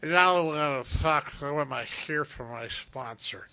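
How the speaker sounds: imitating slurred, drugged words; slurring words. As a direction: slur it like he's stoned on pills. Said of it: "he was slurring his words".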